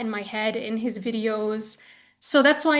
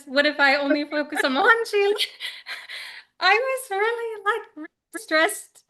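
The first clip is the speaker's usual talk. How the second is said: high-pitched, breaking into laughter; laughing; 8 semitones higher than usual. Real, not acted. Devotee recalling her guilt over two gurus, laughing. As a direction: laugh helplessly through the confession.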